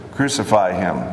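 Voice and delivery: ordinary delivery, nothing marked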